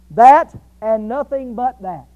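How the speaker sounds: THAT hit loud, then a short break before "and nothing but that"; emphatic. Shown as a demonstration. Preacher shows how Greek emphasis translates, stressing THAT. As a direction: punch the first "that" hard. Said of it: "this is in the emphatic mood".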